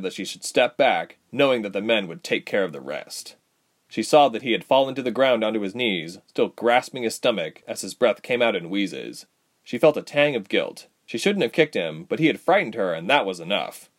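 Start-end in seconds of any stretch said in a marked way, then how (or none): none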